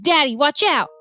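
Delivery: a touch of a warning shout; mild mock scream. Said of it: "and I screamed".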